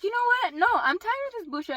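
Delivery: loud, high-pitched exasperation; retold fed-up outburst. Daughter confronting mom, retold fed-up outburst. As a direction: act out being done with it.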